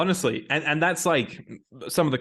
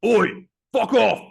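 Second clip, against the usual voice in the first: louder, higher mock shout with a gap between the phrases; Roy Kent; 9 semitones higher, 6 dB louder, slower than usual. Host retrying the footballer's brush-off, exaggerated shouted impression. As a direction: stretch it out and belt it as an impression. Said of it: "I need to do a better".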